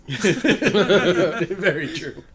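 slow words through loud laughter; laughing. Real, not acted. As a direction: get it out while cracking up. Laughing at punch joke.

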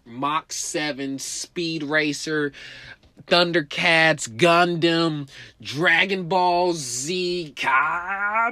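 loud, slow, building anime shout; hyped escape. Retold or imitated. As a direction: build it up like a power-up. Running away hyped.

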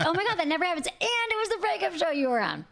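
high, gushing replay of delight; retold excitement. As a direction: gush as if reenacting your thrilled reaction. Retells own flustered delighted reaction.

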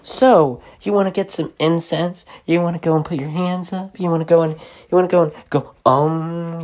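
high-pitched self-help internet personality; high, sing-song soccer mom voice. Retold or imitated. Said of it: "the soccer mom".

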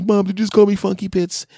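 put-on high, loud outraged child; shocked kid. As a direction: squeal it like a stung little boy.

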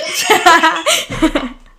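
loud burst of laughter; high, loud cackling laugh. Real, not acted. Cracking up at her own ASMR voice, loud laugh.

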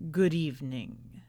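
low, somber greeting. Acted. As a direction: open the show with a grave, low bow of a voice. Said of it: "He opened the show with a somber".

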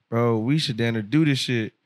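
ordinary talk, nothing marked

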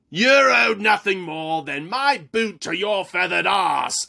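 high, loud dwarf shout; dwarf general shouting at the priest. Acted. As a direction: roar the insult. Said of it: "General Carib shouted".

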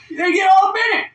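high, loud exasperated outburst; ranting shout. Real, not acted. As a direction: blow up in frustration. Ranting about line-cutting Karen.

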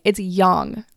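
yang said as "yahng"; corrected pronunciation. Retold or imitated. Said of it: "Why are you all saying yang?".

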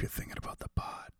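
fast hushed whisper; quick creepy whisper. Pretend. Podcaster whispering to listeners, quick creepy whisper. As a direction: rush it out in a hiss of a whisper.

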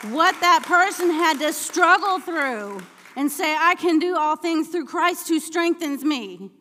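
high, loud, impassioned sermon shout; fervent shouted declaration; full-force preaching. Real, not acted. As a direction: preach it hard, building to a cry of faith.

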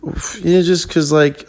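ordinary talk, nothing marked

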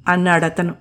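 usual speaking voice

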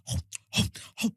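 voiceless chomping gulps; imitated gobbling. Friend showing how she was just eating, imitated gobbling. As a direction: mimic stuffing food in without a breath.

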